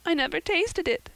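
put-on high girlish protest; a girl insisting innocence; young Anne. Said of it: "said Anne".

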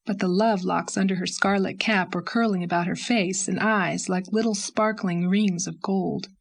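ordinary delivery, nothing marked

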